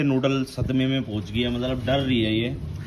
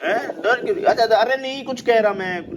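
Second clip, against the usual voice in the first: reassuring the dog; 7 semitones higher than usual. Real, not acted. Owner tells frightened Noodle he won't do anything, high reassuring baby talk. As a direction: promise the pup you mean no harm.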